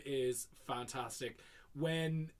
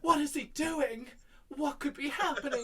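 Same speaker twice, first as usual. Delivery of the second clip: very high, hammed-up wail; mock despair; 10 semitones higher than usual. Fan imitating Janet wondering about Brad, mock despair. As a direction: fret aloud like a melodrama heroine. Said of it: "in despair and shit".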